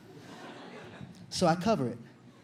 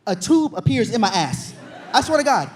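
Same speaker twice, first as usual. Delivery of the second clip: shouting it out, laughing; loud outburst; 7 dB louder than usual. Acted. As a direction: yell the shock of it, half laughing. Shouting the punchline.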